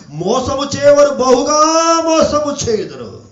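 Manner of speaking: sustained, ringing prophetic proclamation; preaching. Acted. Preacher warning earth's inhabitants from scripture, full preaching voice. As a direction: proclaim it like a warning, stretching the endings.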